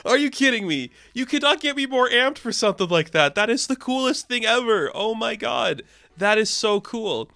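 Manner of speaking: high, hyped-up disbelief; retelling own giddy reaction; excited fanboy gush. Retold or imitated. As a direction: gush like a thrilled fan. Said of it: "And I'm like".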